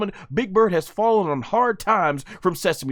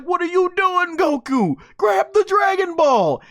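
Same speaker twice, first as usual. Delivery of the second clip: exasperated outburst; 8 semitones higher than usual. Retold or imitated. Retelling his reaction, shouting at Goku to grab the ball. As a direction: yell at the screen like a frustrated fan. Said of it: "I'm like".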